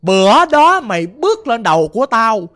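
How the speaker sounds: acted angry spirit, loud bursts on the insults; cursing. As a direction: curse them out as the possessing ghost. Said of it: "Bà chửi bạn như vậy đó".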